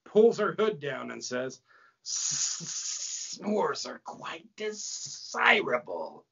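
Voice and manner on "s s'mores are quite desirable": slow, hissing snake woman; slithery, drawn-out demon voice; snake-faced demon. A narrator's reading. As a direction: stretch out the hissing S sounds. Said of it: "woman snake-faced demon named Slithotheus".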